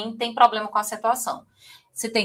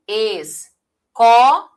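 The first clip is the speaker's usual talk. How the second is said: escola drawn out very slowly, "co" louder; syllable stress demo; 8 dB louder, much slower than usual. Shown as a demonstration. Teacher demonstrating to students the stronger syllable in escola. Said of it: "tem sempre uma sílaba que é mais forte".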